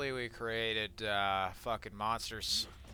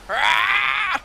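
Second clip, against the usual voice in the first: excited scream; 12 dB louder than usual. Real, not acted. Wild chainsaw kill, screaming.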